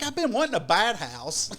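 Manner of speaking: lightly acted delight; imitated excited partner. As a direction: mimic gleeful enthusiasm. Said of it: "she was all excited for the bat".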